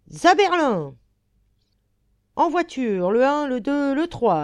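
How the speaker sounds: high, loud call-out; rallying call. Acted. Mechanic calls passengers aboard for a charge on the Prussians, rallying call.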